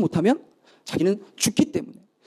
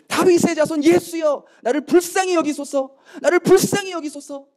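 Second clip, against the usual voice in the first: high, urgent plea for mercy; imitated desperate cry; 7 semitones higher than usual. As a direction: call out to Jesus like a desperate beggar. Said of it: "예수님을 부르짖고".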